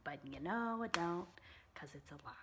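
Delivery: soft conspiratorial whisper; whispered aside. Real, not acted. Admitting to viewers the tan is a lie, whispered. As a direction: whisper the truth like a secret.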